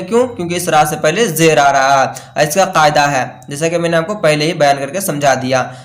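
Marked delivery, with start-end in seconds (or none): none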